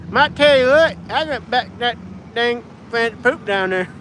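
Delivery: high-pitched Southern backwoods drawl; backwoods American accent. Acted. Streamer casually slipping into backwoods accent, high-pitched. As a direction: put on a thick backwoods American twang. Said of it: "casually goes to the American backwoods accents".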